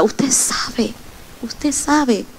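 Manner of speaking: a soft insinuating aside to the congregation; hushed knowing aside. Real, not acted. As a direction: say it quietly, as a knowing hint.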